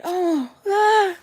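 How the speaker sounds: high gasp into a scream; mock shriek of alarm; retold scream. Seeing spider while washing dishes, screaming.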